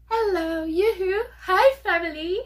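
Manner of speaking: high, loud, sing-out greeting; excited calling out. Pretend. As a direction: holler a playful yoo-hoo to the family. Calls out greeting to listeners.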